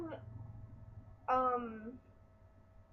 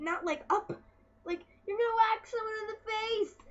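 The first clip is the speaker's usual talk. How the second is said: high, loud scolding rant; exasperated yell; 7 semitones higher, 7 dB louder than usual. Retold or imitated. Yelling at the boys swinging hockey sticks. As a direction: tell them off in disbelief. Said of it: "I'm like".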